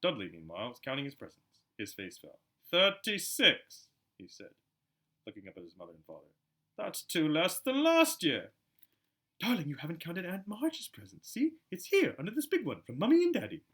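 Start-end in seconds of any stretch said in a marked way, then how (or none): 2.7-3.9 high, very slow whiny count; sulky Dudley. Acted. Spoiled Dudley counting presents. 6.8-8.5 high, slow spoiled whine; whiny Dudley. Acted. Dudley complains. 9.4-13.6 high sugary coaxing voice; cooing mother. Acted. Petunia soothes Dudley.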